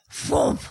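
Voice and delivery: short breathy burst of imitated tearing; ripping whoomp. Mom showing how she tore the toy, explosive whoomp. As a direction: puff out a sudden "whoomp". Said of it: "and I went".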